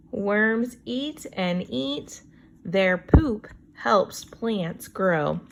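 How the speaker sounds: put-on slow, clear read-aloud for kids; reading to children. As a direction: read each word out slowly for young listeners. Teacher reads book text slowly.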